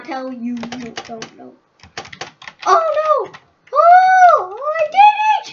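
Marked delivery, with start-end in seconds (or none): 2.7-5.5 high, loud cheer of "I did it"; triumphant shout. Real, not acted. Gamer cheering his success to his mommy.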